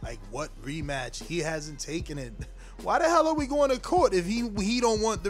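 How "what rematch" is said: usual speaking voice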